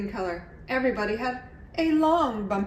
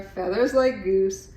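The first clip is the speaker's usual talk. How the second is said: plain reading voice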